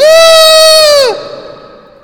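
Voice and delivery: very high, very loud imitated scream; friend's scream. Friend in next-door bedroom joining in, screaming. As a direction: shriek like a startled woman next door. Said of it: "Catherine in the re-, next, next door bedroom went".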